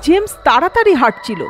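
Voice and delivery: ordinary delivery, nothing marked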